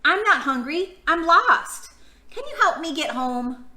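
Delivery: high, small pleading bunny voice; a little lost creature asking for help; child bunny pleading, lost. Acted. Buns voiced in picture book.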